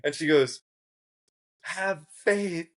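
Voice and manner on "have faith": high, small earnest voice; hopeful girl urging. Retold or imitated. Girl looking back at the speaker, high earnest urging.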